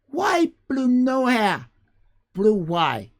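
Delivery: high, loud, slow broken words; squeaky mangled future English, words jumbled; future generations speech. Retold or imitated. Streamer imitating how future generations will talk, squeaky and broken. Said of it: "They kind of speak like that".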